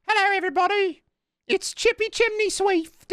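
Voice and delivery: very high little British orphan; Chippy Chimney Swift. Acted. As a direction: squeak out a streamer intro as a British waif. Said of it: "little British orphan playing, uh, playing Fortnite".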